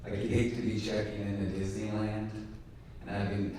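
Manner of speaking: usual speaking voice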